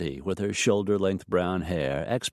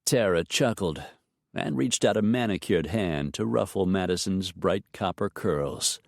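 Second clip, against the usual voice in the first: usual speaking voice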